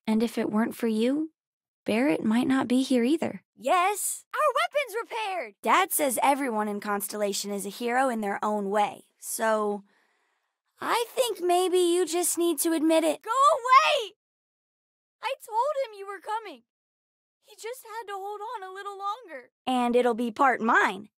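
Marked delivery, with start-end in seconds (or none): 3.6-5.5 high-pitched, elated cheer; thrilled battle report; excited cheer. Acted. 13.2-14.1 high, loud shout; shouted, high-pitched dismissal; angry shout. Acted. Child chasing someone off. 15.2-19.5 high, hushed, tearful lament; small voice close to crying; grieving. Acted. Child grieving for someone lost.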